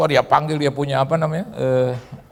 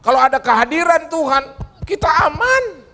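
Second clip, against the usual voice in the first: much higher, loud preaching call; preaching shout; 3 dB louder than usual. Acted. Preacher calling out to the congregation, shouting high. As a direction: proclaim it to the whole hall.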